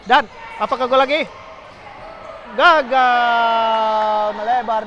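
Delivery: rising loud shout, then drops; excited. Real, not acted. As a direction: hype the chance, then groan it off. Near goal then miss.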